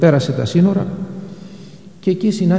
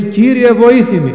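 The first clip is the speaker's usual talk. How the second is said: higher, louder imploring cry for help; pleading; 6 semitones higher, 4 dB louder than usual. Retold or imitated. Mother at Christ's feet begging help for her daughter, pleading. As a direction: beg him with everything you have. Woman falls at his feet.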